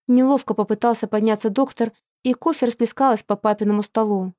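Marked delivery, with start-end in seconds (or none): none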